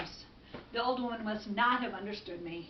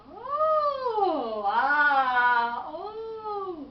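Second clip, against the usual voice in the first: exaggerated singsong realisation; knowing oohs and ahs; 5 semitones higher, 8 dB louder, much slower than usual. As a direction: draw out exclamations of dawning understanding. Wise woman's exaggerated realisation.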